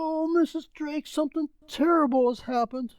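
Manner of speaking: high, breathless servant voice; frightened butler breaking the news; alarmed servant. Acted. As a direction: blurt out the bad news. Servant brings bad news.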